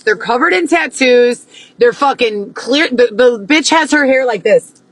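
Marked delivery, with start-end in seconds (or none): none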